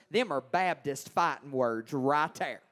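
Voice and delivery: usual speaking voice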